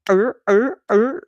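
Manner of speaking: loud, high mock seal barking; sea lion bark. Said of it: "you know, the SeaWorld sea lions".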